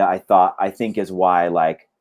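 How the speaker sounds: normal talking voice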